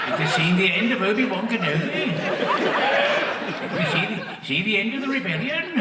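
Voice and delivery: little high voice playing the villain; Dave Prowse. Comic imitates costumed actor filming villain lines, little high voice. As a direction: mimic a high, small-voiced would-be villain. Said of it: "He got a little voice like that".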